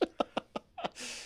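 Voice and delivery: soft run of helpless giggles; trailing breathless chuckles; real laugh. Real, not acted. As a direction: crack up at your own attempt. Laughs at own accent.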